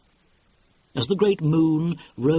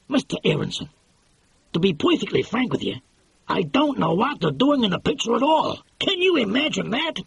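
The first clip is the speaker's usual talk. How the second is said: higher, pushy producer voice; lightly voiced excitable producer; brash film producer; 5 semitones higher than usual. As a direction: sell it like a fast-talking movie boss. Producer retelling the rushes.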